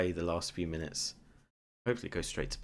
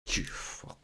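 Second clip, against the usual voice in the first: whispered relief; much slower than usual. Real, not acted. Tense penalty shootout relief.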